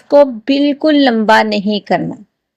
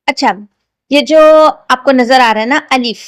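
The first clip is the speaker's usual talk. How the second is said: ordinary talk, nothing marked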